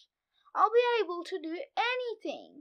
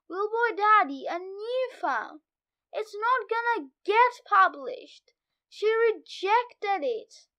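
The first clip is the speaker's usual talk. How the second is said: plain reading voice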